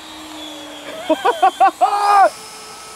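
a high burst of thrilled laughter; a happy laugh at the plane; delighted laugh. Real, not acted. Delighted at the slow flight.

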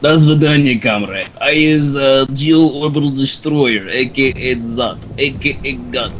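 slow, heavy mock Russian accent; boastful Russian accent, words drawn out. Shown as a demonstration. Friend asked for a Russian accent, mock Russian accent. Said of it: "can you do Russian accent?".

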